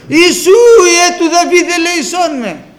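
high, full-voiced shout for mercy; Βαρτίμιος crying out. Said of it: "αρχίζει να φωνάζει".

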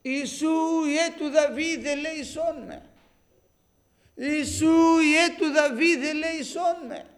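loud, high, sustained plea; crying out for mercy. Retold or imitated. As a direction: call out at full voice like the blind man begging to be heard. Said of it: "βάζει τις φωνές". (δυνατή ικετευτική κραυγή)

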